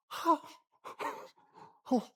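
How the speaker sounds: high gasp falling into a breathy laugh; astonished gasp. Shown as a demonstration. Preacher showing the congregation's reaction, sharp gasp. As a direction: catch your breath in amazement. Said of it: "이렇게".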